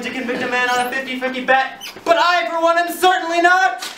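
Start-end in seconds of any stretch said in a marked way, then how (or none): 2.0-3.9 loud, rising lawyer's flourish; courtroom oratory. Acted. Defense lawyer demanding the judge throw the case out, loud and high. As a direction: build to a louder, higher climax for the jury.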